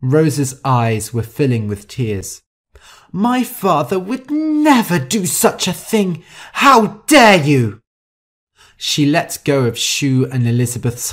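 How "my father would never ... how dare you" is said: high indignation building to a loud shout; outraged. Acted. Rosa refusing Shu's story about her father, outraged.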